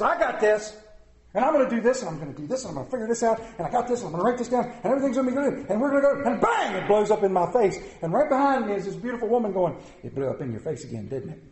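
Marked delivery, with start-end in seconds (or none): 0.0-6.3 rapid, piled-up boasting that builds; overconfident rush. Acted. Husband telling his wife his big plan, rushed overconfidence. 10.0-11.4 low, soft dry aside imitating a wife; knowing wifely aside. Wife behind her husband after his plan blows up, low knowing aside. Wife's remark voiced after the blowup.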